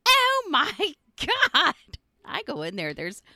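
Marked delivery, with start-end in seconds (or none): 0.1-1.7 high, loud disgusted "oh my God"; shocked gasp. Retold or imitated. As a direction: recoil at the gross tub. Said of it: "and he's like".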